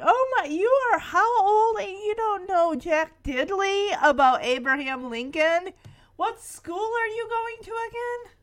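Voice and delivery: mock-appalled questioning; mock disbelief. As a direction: imitate someone appalled at such ignorance. Mocking friends' disbelief at him.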